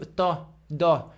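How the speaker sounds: "Tó, dó" with small rounded lips; "Tó, dó" light, vowel rounded forward; French pouted syllables. Shown as a demonstration. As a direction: say "Tó, dó" through a French pout. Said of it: "lá na França eu falo do mais de biquinho".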